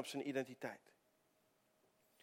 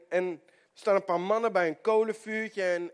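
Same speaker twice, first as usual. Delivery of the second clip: normal talking voice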